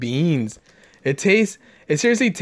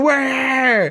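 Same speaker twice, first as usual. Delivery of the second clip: high cry-like yell, higher pitch; screaming for the driver; 8 semitones higher than usual. Retold or imitated. Family screaming at the pizza guy, high pleading yell. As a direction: scream it so he finally hears. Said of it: "We're, like, screaming".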